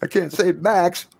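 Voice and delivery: put-on high, slow child on the verge of crying; tearful child. Child confesses she can't save her friend, tearful high voice. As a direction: play a small child starting to cry. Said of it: "Starts crying".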